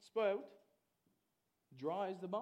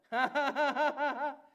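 loud, high staged laughter; mock laugh; 8 semitones higher, 11 dB louder than usual. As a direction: burst out laughing at a refusal. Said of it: "What are you laughing at?".

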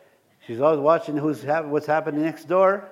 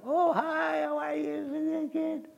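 sweet mock greeting; 13 semitones higher, 4 dB quieter, much slower than usual. Greeting lady in store.

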